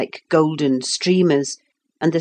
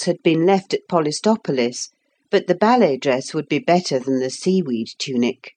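ordinary delivery

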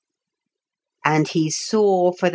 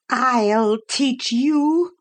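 slow, high, furious threat; 7 semitones higher, much slower than usual. Acted. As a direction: spit the threat out in fury. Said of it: "said the furious Pretenderette".

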